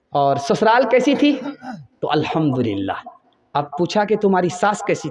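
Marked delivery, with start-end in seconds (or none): 2.0-3.1 much lower and softer, murmured; a shy, contented aside; daughter's soft reply. Acted. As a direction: daughter telling her mother about in-laws. Daughter answers her mother.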